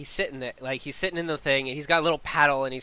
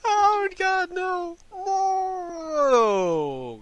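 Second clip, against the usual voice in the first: high, drawn-out acted wail; mock despairing wail; much slower than usual. Little man drifting away downriver, high hammed-up wail. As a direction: wail it high, sliding down at the end.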